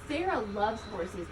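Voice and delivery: ordinary delivery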